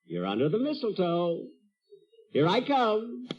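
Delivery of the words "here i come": hammy suitor. Acted. Jack announcing his mistletoe kiss.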